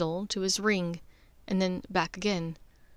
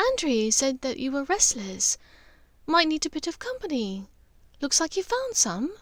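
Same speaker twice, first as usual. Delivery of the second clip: higher motherly voice, kindly teasing her son; Narcissa, gentle mother; 6 semitones higher than usual. Acted. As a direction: play the caring mother checking on her son.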